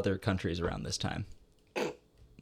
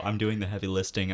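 ordinary talk, nothing marked

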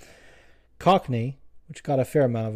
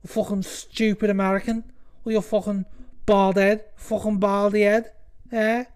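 put-on high-pitched Scouse accent trash talk; mock Scouse insults, high-voiced; Till; 8 semitones higher than usual. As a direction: imitate a riled Scouse fighter, pitched right up. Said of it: "Till's like".

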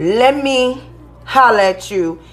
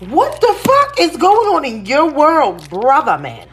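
ordinary talk

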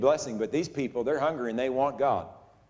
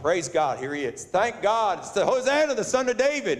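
put-on excited praising kids; 5 semitones higher than usual. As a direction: imitate children shouting praise, pitch climbing high. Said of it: "these kids are like".

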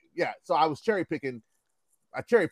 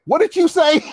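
high, loud, incredulous demand; angrier father; 12 semitones higher, 6 dB louder than usual. Retold or imitated. Father challenging the cocky boy, acted incredulous anger. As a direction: imitate a dad who can't believe his ears. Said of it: "he got angrier".

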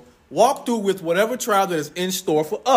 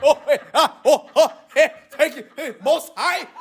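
panicked praise; 6 semitones higher, much slower than usual. Retold or imitated. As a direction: cry out to God mid-crash. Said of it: "When you flip a car".

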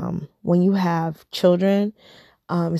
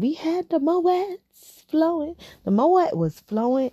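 excited; 9 semitones higher than usual. Acted. Bragging about the champagne.

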